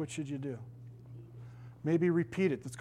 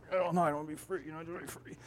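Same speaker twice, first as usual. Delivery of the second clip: lightly voiced resisting woman, trailing off; a hint of a reluctant possessed woman; refusing woman; 7 dB quieter, faster than usual. Woman refusing the pastor's card, mild reluctant murmur.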